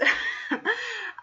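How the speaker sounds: sudden high-pitched laugh. Real, not acted. Laughs at own burp voice.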